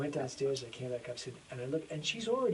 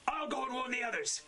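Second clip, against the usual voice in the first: much higher-pitched character read; urgent acted line; 11 semitones higher than usual. Actor as character rushes to warn the others, high and urgent.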